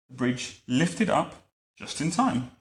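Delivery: ordinary delivery, nothing marked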